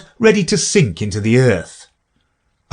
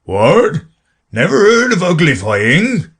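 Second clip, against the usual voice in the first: louder, gruff astonished exclaim; surprised Gryphon; 8 semitones higher, 4 dB louder than usual. A narrator's reading. Gryphon astonished that Alice never heard of uglifying. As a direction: exclaim in disbelief. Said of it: "it exclaimed".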